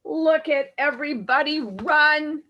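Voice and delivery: ordinary delivery, nothing marked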